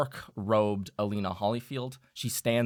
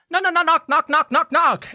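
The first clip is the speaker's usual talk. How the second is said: knocking sounds; 11 dB louder than usual. Retold or imitated. Imitating frantic door knocks.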